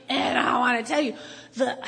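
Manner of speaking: ordinary delivery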